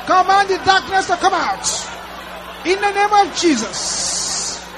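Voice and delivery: high, loud shouted deliverance prayer; fiery preaching. Real, not acted. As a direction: command the darkness out at full force. Deliverance prayer shouted at full force.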